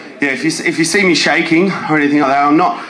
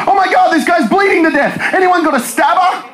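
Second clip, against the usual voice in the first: mock panic; 11 semitones higher than usual. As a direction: yell for help in a hammed-up panic. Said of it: "That's like".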